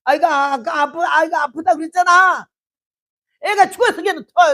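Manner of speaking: imagined outburst at the husband, loud and high; mock shouting at husband. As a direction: blurt the news out at full volume. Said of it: "나 같으면 남편한테 한바탕에".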